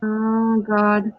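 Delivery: normal talking voice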